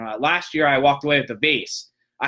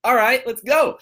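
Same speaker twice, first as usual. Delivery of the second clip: pumped up; 8 semitones higher, 4 dB louder than usual. Retold or imitated. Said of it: "I'm like".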